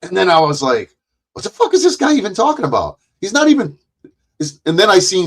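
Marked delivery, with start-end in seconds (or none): 1.3-3.8 high, rapid retold disbelief; bewildered. Telling viewers his first reaction to the channel, bewildered. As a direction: replay your baffled reaction, fast and high.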